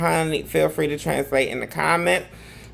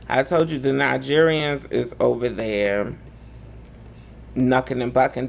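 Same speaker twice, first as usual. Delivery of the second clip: normal talking voice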